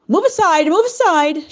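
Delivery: loud, high order to clear the way; shouting servant. Acted. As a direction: shove through the crowd with your voice. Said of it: "shouted the servant".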